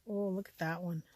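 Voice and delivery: ordinary talk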